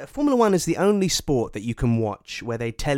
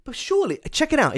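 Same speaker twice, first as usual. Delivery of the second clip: excited; 13 semitones higher, 5 dB louder than usual. Real, not acted. Excited plug.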